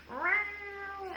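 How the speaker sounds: high squeaky imitated meow; cat meow. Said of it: "she heard the cat go".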